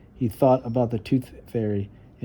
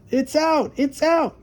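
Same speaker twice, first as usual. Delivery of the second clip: much higher delighted cry; excited shout; 4 dB louder than usual. Acted. Arthur shouting that his tooth came out, high and thrilled. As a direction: yell it with glee. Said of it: "shouted Arthur".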